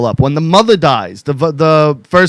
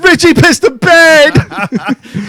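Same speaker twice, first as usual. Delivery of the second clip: high, slow sing-song taunt; drawn-out high mocking jeer; 11 semitones higher, 3 dB louder, much slower than usual. Wife mocking him on Facebook.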